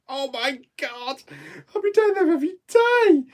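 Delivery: giddy excitement. Real, not acted. Telling viewers about a Toby Carvery opening, squealing with giddy joy. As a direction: squeal with delight at the thought.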